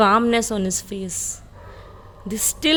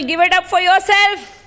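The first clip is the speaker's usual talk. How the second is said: higher, louder cheer; 6 semitones higher, 6 dB louder than usual. Real, not acted. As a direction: hype up the crowd. Cheering the challenger.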